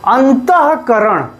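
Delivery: slow अंतःकरण with अंत pushed hard; stress on ANT. Shown as a demonstration. Teacher showing students stress on अंत in अंतःकरण. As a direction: lean on अंत in अंतःकरण, drawn out. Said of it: "इस पर बल हम अधिक दे रहे हैं".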